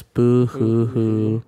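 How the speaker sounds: fake crying. Mock sad boy crying.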